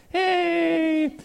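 high, sing-song hey; a bright chirped greeting; chirpy high hello; happy high hey. Shown as a demonstration. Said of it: "This is just me, like".

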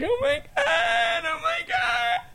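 very high, slow mock sobbing; hammed-up squeaky crying voice; drunk girl crying. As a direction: wail it like a tearful drunk girl. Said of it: "drunk girls come out crying".

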